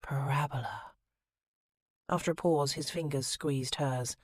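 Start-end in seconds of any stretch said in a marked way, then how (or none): 0.0-0.9 hearty, breathy and teasing; breathy whisper. Acted. Woman teasing her lover with a math term, breathy whisper. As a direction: breathe the word out suggestively. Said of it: "hearty, breathy voice she whispered".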